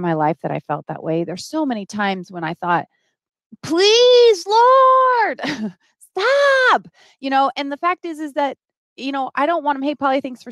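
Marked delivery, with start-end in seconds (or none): 3.6-6.9 high, loud plea to the Lord; anguished high shout of please, Lord, stop; desperate cry. Retold or imitated. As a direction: cry it out to God, begging. Retold desperate cry to God.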